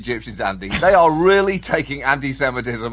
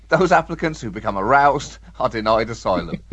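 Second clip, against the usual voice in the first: normal talking voice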